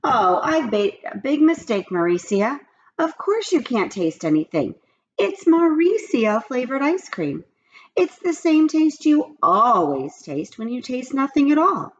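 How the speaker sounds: lightly voiced apologetic teacher; a hint of rising dismay; teacher realizing. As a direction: realize the mistake aloud. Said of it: "Missus Jules".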